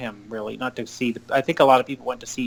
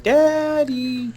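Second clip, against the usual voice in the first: put-on much higher, a small kid calling out; child's voice; 13 semitones higher, 3 dB louder than usual. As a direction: call it out like a little boy. Child calling his dad.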